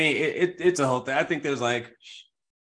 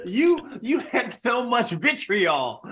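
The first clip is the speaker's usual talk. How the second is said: laughing; 9 semitones higher, much slower than usual. Real, not acted. Laughing at friend's roast lines.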